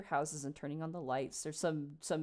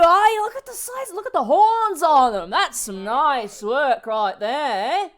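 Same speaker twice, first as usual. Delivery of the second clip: much higher, much louder hearty bellow; rowdy dwarf; 9 semitones higher, 11 dB louder, slower than usual. Acted. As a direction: holler admiringly across the street. Dwarf admiring Cliff.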